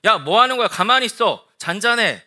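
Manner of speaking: acted angry rebuke, forceful; shouted scolding. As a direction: shout down the sea like an unruly child. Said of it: "소리를 지르는 거죠".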